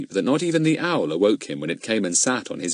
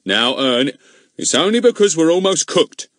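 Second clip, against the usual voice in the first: honey-like tones; 8 semitones higher, 6 dB louder than usual. Acted. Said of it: "speak in honey-like tones".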